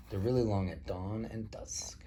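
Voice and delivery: whispered storybook aside. A narrator's reading. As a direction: lean in and whisper it. Narrator whispers a detail.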